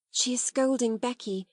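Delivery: plain reading voice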